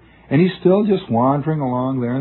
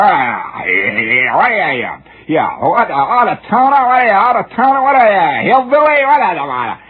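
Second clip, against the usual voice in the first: high, loud sneering taunts; mock hollering; 6 semitones higher, 3 dB louder, slower than usual. Two men hollering insults in the rain, mocking shout. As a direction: jeer like a street brawler. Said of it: "both of them are hollering one at the other".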